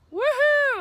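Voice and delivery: high loud joyful whoop; excited whoop. Real, not acted. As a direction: celebrate the milestone. One third of trail done.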